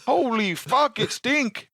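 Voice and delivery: hammed-up broken English outburst; mock accent. Coworker voicing a nurse in a high mock accent.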